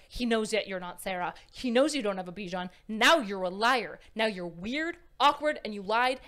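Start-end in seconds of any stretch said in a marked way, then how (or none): none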